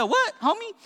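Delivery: high-pitched mock disbelief; puzzled listener. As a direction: jump up high like a baffled listener. Voices a puzzled listener's reply.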